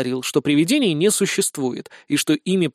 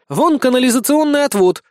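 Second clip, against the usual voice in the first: high, loud excited call; exclaiming; 9 semitones higher, 5 dB louder than usual. A narrator's reading. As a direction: call out a sudden discovery. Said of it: "воскликнула Безос". (восклицая, высоко и громко)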